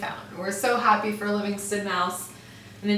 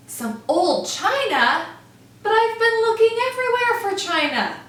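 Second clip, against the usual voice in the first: excited squeal; 13 semitones higher, 7 dB louder than usual. Acted. Mouse excited discovery.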